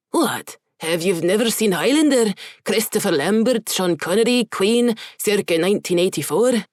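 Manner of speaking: Scottish brogue easing back to normal; fading Scottish accent. Acted. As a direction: let the Scottish accent fade off. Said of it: "Gerald toned the brogue down by degrees".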